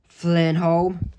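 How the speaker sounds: usual speaking voice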